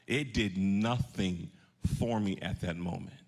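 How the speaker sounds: drawn out and dropping low; slow, falling conviction. Real, not acted. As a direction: let it sink slowly. Sermon's emotional low point.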